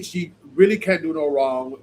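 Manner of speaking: usual speaking voice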